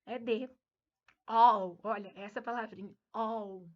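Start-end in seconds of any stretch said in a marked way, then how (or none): 1.3-1.8 "All" with an open O sound, not an A; English "all". Shown as a demonstration. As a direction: say "All" like "ól", a clear O vowel. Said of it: "Escreve com A, mas não tem som de A".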